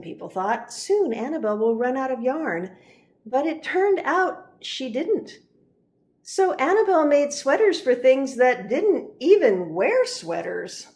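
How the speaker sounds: usual speaking voice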